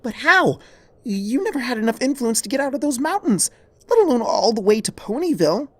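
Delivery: high, loud, incredulous protest; stunned disbelief. Acted. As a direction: refuse to believe it, voice climbing. Twilight disbelieving, cutting in.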